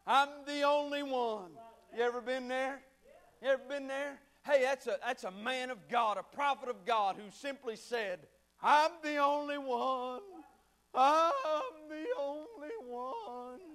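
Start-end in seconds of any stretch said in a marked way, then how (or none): 0.0-1.5 loud cry to God, then easing; depressed lament. Acted. Elijah depressed on the mountain to God, despairing lament. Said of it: "you find him up there depressed". 8.6-13.6 slow, drawn-out wail; depressed lament. Acted. Prophet alone on the mountain, drawn-out depressed lament. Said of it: "you find him up there depressed".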